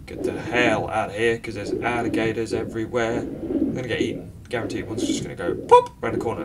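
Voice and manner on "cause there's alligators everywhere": ordinary talk, nothing marked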